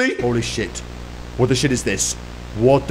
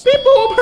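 high, loud beep-boop noise; bright door-chime beeps; robot beep; 7 dB louder than usual. Pretend. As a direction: mimic an electronic chime.

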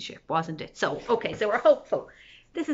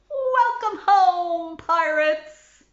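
slow, loud, high greeting; loud welcome; 7 semitones higher, 7 dB louder, much slower than usual. Acted. Villagers loudly welcoming the pirates home. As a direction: call out a big welcome.

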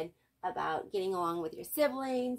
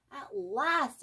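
exclaiming; 6 semitones higher, 4 dB louder than usual. A narrator's reading. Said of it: "exclaimed Arthur".

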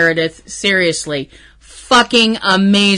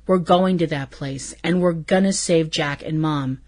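quiet earnest quote; 4 semitones lower, 7 dB quieter than usual. Retold or imitated. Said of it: "Sam answers him".

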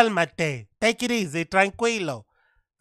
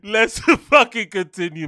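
ordinary delivery

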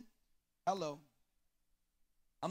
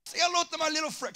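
higher-pitched, lightly voiced movie gangster line; Scarface quote; 7 semitones higher than usual. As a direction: toss off a quick Scarface impression. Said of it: "my hero was Scarface".

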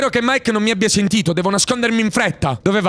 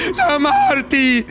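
slow, higher crying shout; panicked cry; 5 semitones higher, slower than usual. Acted. Reading a frightened character's line, slow crying shout. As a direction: wail it in panic.

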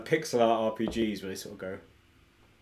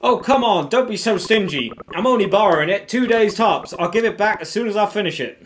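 pleading, pitched up, a bit louder; pleading game character; 9 semitones higher, 6 dB louder than usual. Acted. Borrower begs a friend to lend something, pleading and higher. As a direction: wheedle in a raised character voice.

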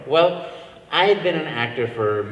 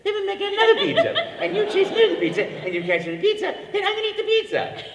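much higher, louder laughing Mario; 6 dB louder than usual. Retold or imitated. Mario keeps riffing at the audition, high and laughing. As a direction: pile on pasta giddily as Mario. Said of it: "so I said".

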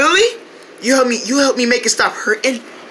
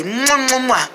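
between kisses; 3 dB quieter, slower than usual. Acted. Kisses crying friend.

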